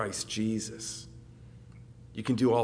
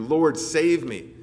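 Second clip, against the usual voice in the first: "Lord, save me" said slowly and louder, drawn out; sincere plea; 4 semitones higher, 6 dB louder, slower than usual. Shown as a demonstration. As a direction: cry it out slowly, in full earnest. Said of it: "if you pray that prayer sincerely".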